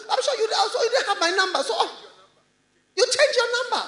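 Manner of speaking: high, loud, rattled-off mock apology; excuse-maker. Preacher mimicking people who claim they didn't know, mock excuses. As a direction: pile up hammed-up excuses in a very high voice. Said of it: "that's how they talk".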